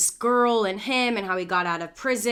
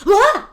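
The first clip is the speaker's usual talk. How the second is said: sudden high, loud yelp; imitated outburst; 12 semitones higher, 12 dB louder than usual. Imitating the man who turned to her, sudden loud outburst. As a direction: blurt out a mock yell, far above your voice. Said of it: "he literally ... was like".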